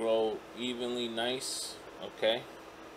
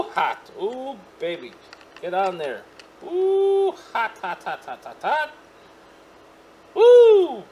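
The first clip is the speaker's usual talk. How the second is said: loud high woos between hits; excited whooping; 11 semitones higher, 11 dB louder than usual. Real, not acted. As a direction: whoop after each hot pull. Repeated hot hits, whooping.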